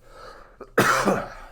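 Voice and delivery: real, loud cough; a real cough from being sick. Real, not acted. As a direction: cough hard to clear it. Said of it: "pardon me a second".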